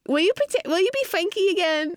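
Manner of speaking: high-pitched imitation of a toddler asking; small high child's voice, putting in a request; Evie, pleading. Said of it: "She'll be like".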